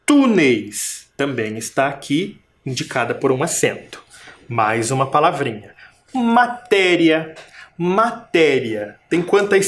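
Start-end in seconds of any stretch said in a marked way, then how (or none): 0.0-1.1 Túneis, TÚ punched then falling; stress on first syllable. Shown as a demonstration. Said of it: "Identificou a sílaba tônica?". 6.1-9.0 Matéria slowly, MA apart and TÉ stretched; stressed-syllable demo. Shown as a demonstration. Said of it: "Também tem sílaba tônica".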